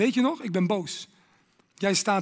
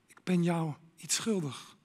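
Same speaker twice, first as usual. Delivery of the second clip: guilty inner whisper; 3 semitones lower, 7 dB quieter than usual. Acted. Preacher whispering a guilty person's thought. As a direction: whisper the confession.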